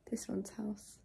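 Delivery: a hushed, breathy aside; whisper. Real, not acted. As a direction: whisper it like a little secret. Whispering about sleeping boyfriend.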